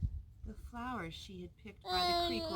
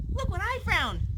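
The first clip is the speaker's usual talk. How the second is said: bright, loud show-and-tell cry, higher and louder; a boy thrusting out his prize frog; excited discovery; 11 semitones higher, 9 dB louder than usual. Acted.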